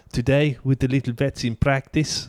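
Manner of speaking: slow South African presenter imitation; measured South African lilt; South African accent. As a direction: mimic a South African TV vet. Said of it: "South African accent".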